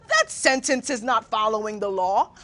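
dropping low in patient mock correction; mock grammar correction. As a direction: gently lay down the law to a parent. Corrects mother's grammar in acted exchange.

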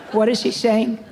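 normal talking voice

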